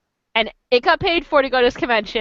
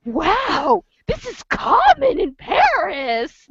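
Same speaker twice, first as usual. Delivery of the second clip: high, slow wide-eyed wonder; lightly voiced naive mother; mock astonished mom; mom amazed; 5 semitones higher, much slower than usual. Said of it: "my mom's like".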